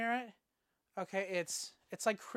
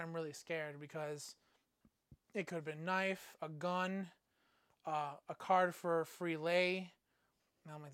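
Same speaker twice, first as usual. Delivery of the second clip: normal talking voice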